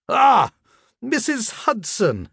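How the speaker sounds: high, drawn-out landlady voice; landlady's demand. Acted. Landlady cornering her lodger on the stairs, high demanding voice. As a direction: pounce and demand, slow and high.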